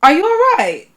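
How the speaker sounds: a bright British "Are you all right?"; a hint of a London greeting, "Are you all right?". Shown as a demonstration. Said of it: "a very popular phrase".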